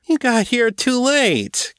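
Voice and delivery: put-on high, slow drunken whine; complaining. A narrator's reading. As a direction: complain loudly, dragging the words. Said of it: "Kate complained".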